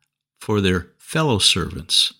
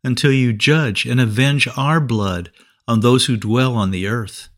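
ordinary talk

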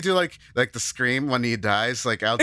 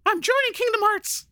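cartoon mouse voice. High cartoon voice.

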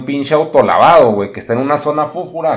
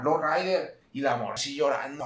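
ordinary talk, nothing marked

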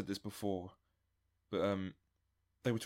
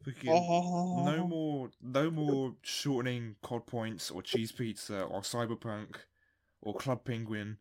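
slow emphatic rant; slower than usual. Real, not acted. Laying down a new rule.

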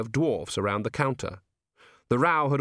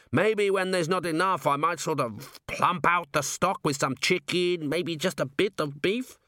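higher-pitched, halting admission, mildly mumbled; reluctant mumble; 8 semitones higher than usual. A narrator's reading. Cornered cook confesses padding the stock, reluctant mild mumble. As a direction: own up bit by bit. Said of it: "he mumbled".

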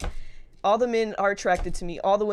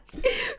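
high laugh. Real, not acted. Laugh in story.

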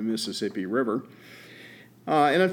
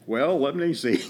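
usual speaking voice

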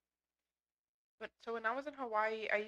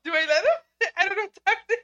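higher and much louder, laughing through the words; excited giggling; 11 semitones higher, 16 dB louder than usual. Real, not acted. Guest giggling as the cat climbs onto her, excited. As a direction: squeal with delight as the cat comes close.

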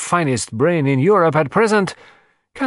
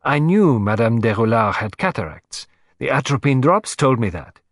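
higher and quicker, self-reproaching Poirot; animated; 5 semitones higher, 3 dB louder than usual. Acted. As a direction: burst out with animated frustration. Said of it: "becoming suddenly animated".

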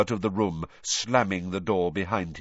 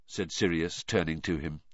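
ordinary delivery, nothing marked

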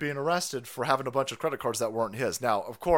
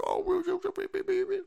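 mock whimper. Mock whimpering after crying line.

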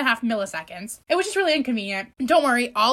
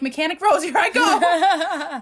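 mock heroic; 5 semitones higher, 4 dB louder, slower than usual. Mock heroic self-announcement.

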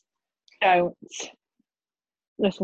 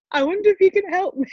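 much higher, fast, overly polite; prim operator call voice; polite caller; 14 semitones higher, 3 dB louder, much faster than usual. Retold or imitated. Retelling her call to emergency services, prim and polite. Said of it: "like you're calling a fucking operator".

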